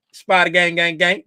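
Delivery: ordinary talk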